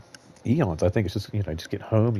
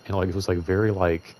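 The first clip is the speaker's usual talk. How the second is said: normal talking voice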